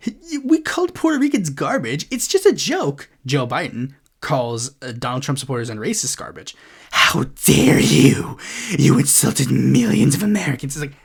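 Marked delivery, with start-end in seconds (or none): 0.1-3.1 high, dismissive mock voice of a conservative. Vlogger mocks conservatives' double standard, high dismissive voice. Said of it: "conservatives are like". 6.9-10.8 loud, slow, breathy fake outrage; mock outrage. Vlogger parodies offended Trump fans, loud mock outrage. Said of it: "mock outrage quote".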